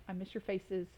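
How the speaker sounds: ordinary delivery, nothing marked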